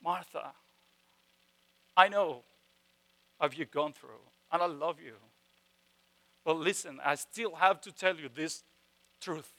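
soft, tender words of truth; loving tone. Retold or imitated. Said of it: "Like a".

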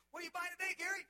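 high, bright clerk greeting a regular; cheery clerk. Retold or imitated. Store clerk greeting a regular kid customer, high and cheery. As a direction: sing it out like a friendly store clerk.

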